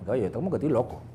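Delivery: embarrassed mutter. Retold or imitated. Self-doubting aside.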